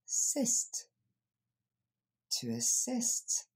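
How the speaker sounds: "Assist" as uh-SIST, weak first vowel, open "e"-free English; careful model pronunciation. Shown as a demonstration. Teacher demonstrates the sounds for learners, careful "assist". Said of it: "c'est le son E qu'on va entendre".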